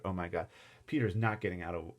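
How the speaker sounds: ordinary delivery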